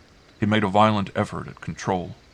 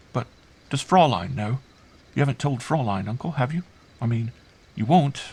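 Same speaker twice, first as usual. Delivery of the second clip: put-on high child voice, rising questions; sudden worried thought; 6 semitones higher than usual. A narrator's reading. Girl checking uncle hasn't told Fraulein, anxious. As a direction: blurt it out as a child's afterthought. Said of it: "she added quickly as the thought struck her".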